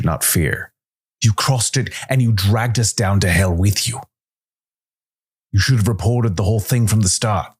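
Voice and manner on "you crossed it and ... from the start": higher, pressing angry voice; trembling with rage at his captain; accusing the captain, raised in anger. A narrator's reading. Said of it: "His hands trembled, but with rage".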